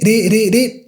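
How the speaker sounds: "ri" with one quick tap of the tongue; soft tapped R. Shown as a demonstration. Teacher repeating the soft R syllable. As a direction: tap the ri lightly. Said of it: "same sound as the soft R".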